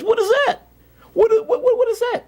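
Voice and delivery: very high, astonished clown. Acted. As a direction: squeak it like an amazed clown. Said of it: "He looks up and says".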